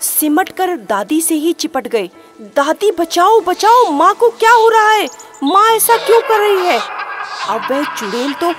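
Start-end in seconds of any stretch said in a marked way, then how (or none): 2.4-7.0 high, loud panicked pleading; children begging grandmother for help; frightened children. Acted. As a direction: cry out to grandma in terror. Children clinging to grandmother, crying out.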